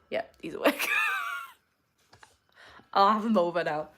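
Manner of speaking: ordinary talk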